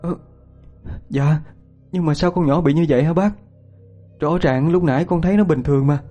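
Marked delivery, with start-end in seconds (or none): 1.1-6.0 high-pitched anxious mother; tearful. Acted. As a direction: explain through tears, voice held high. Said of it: "chị Tám nước mắt ngắn dài".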